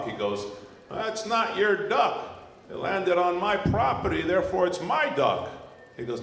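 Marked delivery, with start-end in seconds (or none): 0.9-5.4 high, emphatic farmer laying claim; burly farmer. Acted. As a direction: argue like a farmer on his own land. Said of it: "big, burly farmer comes out and says".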